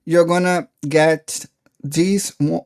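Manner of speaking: ordinary talk, nothing marked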